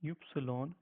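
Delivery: "upsilon" as the German name of Y; German Y in three syllables; German letter Y. Shown as a demonstration. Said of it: "we pronounce it as".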